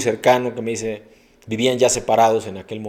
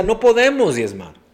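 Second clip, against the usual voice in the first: raised complaint; 10 semitones higher, 7 dB louder than usual. Retold or imitated. Preacher voicing couple's complaint.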